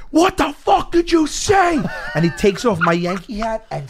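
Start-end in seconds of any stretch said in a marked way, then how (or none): 0.1-1.8 furious shout. Retold or imitated. Wrestler screaming at the heckler in his face, furious yell. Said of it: "he goes".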